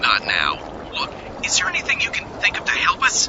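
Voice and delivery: fast urgent whisper; fast breathless whisper; hurried whisper. Acted. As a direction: whisper it quickly, hiding.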